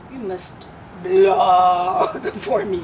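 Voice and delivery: imitating a Greek accent, drawn out slowly; Greek-accented command, stretched out; Arianna Huffington impression. [name] imitating the Greek-accented hostess at a party. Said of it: "with that accent".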